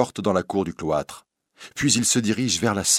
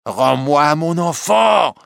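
loud, high, drawn-out demand; anguished shout; 8 semitones higher, 7 dB louder, slower than usual. Acted. Recluse to the bohémienne, shouting in anguish. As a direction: scream for your stolen child.

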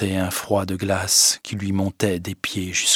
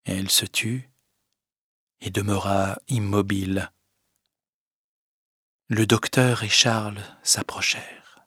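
ordinary delivery, nothing marked

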